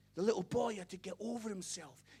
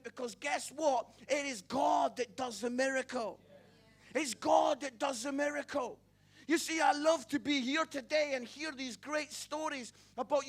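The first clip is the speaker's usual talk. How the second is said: building preacher's call to the congregation, loud on the miracle; full-force preaching; 5 semitones higher, 8 dB louder than usual. Acted. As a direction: preach it out, stirring the room.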